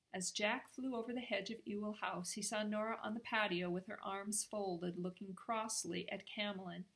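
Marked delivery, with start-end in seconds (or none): none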